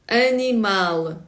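"animal" slowly as a-nee-MAL; slow Portuguese "animal"; stress on last syllable. Shown as a demonstration. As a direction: stretch "animal" onto its final syllable. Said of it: "наголос завжди на останній склад".

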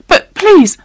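strained, high-pitched plea; desperate high plea, cut short; voice gone wrong. A narrator's reading. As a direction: plead in a high, distressed voice. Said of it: "there was something wrong with his voice".

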